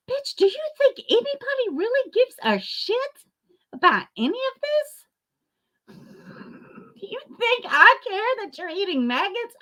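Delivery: high-pitched furious tirade; furious rant. Real, not acted. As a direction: tear into her, voice up high. Ranting straight at Maxwell.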